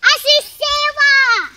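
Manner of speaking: high, loud calling out; excited shout. Real, not acted. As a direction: call out, thrilled. Child calls out excitedly.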